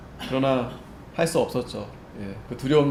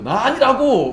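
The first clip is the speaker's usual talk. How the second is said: much higher, louder snapping denial; angry denial; 9 semitones higher, 7 dB louder, slower than usual. Retold or imitated. Peter's third denial, losing his temper, loud. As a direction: turn the blame back on them. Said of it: "자기가 되려 화를 내면서".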